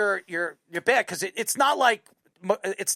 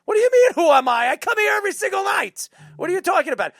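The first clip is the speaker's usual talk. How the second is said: higher and louder, fast, offended; indignant retort; 9 semitones higher, 4 dB louder, faster than usual. Retold or imitated. Answering the clerk who asked who he is, indignant and fast. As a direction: fire it back, how dare you not know me.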